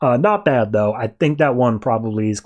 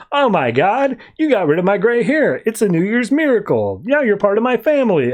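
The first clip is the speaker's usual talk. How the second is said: higher, gushing delight; overjoyed; 7 semitones higher than usual. Retold or imitated. Harley thrilled the baby plucked her gray hair, excited. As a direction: squeal over a tiny miracle. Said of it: "to which Harley's like".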